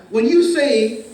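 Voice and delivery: usual speaking voice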